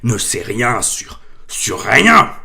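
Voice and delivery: slow, loud furious roar. A narrator's reading. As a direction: roar it at the Dursleys. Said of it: "rugit Hagrid".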